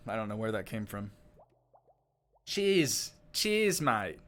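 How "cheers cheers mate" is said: trying out an Aussie accent, a bit higher; slightly off Australian accent. Shown as a demonstration. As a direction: have a go at Australian, not quite nailing it. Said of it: "It's just a little off".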